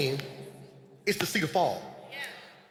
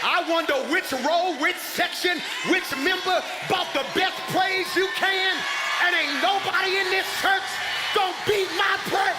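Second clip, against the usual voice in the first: full-force preaching; 11 semitones higher than usual. Acted. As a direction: shout the praise challenge up high like a revival preacher. Pastor opens with fervent praise call.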